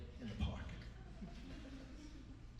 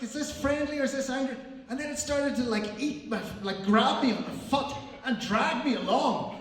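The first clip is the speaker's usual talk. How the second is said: loud, raised-pitch panicked storytelling; mounting panic; 4 semitones higher, 6 dB louder than usual. Retold or imitated. Comic telling the crowd of a dog attack, mounting panic. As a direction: build alarm, voice climbing and getting louder.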